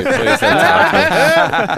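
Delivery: high, loud, slow laughing plea; pleading with the stranger not to talk. Retold or imitated. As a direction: beg him off while laughing. Pleading at approaching guy.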